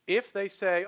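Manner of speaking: ordinary talk, nothing marked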